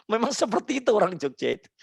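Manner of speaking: ordinary talk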